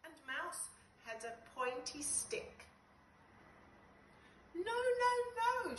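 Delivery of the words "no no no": high, urgent no-no-no; shouting no. Acted. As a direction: stop them with a high cry. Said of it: "shouted Bug".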